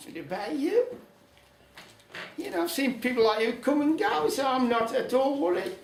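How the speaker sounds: put-on high, cocky old woman's voice; breezy and dismissive, pitched well up; old lady scoffing. Old lady shrugging off the devil's powers, high and cocky.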